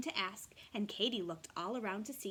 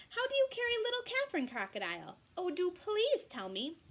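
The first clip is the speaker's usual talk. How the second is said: pleading kangaroo voice; 8 semitones higher, 5 dB louder than usual. Acted. Katy pleads.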